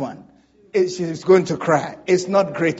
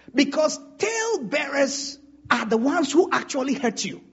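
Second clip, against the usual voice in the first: normal talking voice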